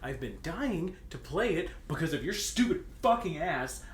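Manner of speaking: ordinary delivery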